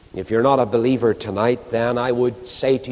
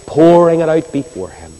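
pouring said high and loud, falling at the end; emphatic cry; 5 semitones higher, 7 dB louder than usual. Real, not acted. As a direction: lean hard on pouring it out before Him. Preacher repeats phrase for emphasis.

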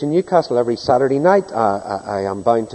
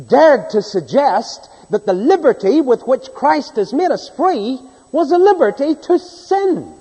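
slow, loud pulpit preaching; 8 semitones higher, 5 dB louder, slower than usual. Real, not acted. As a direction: preach the verse with full force. Preaching at full force.

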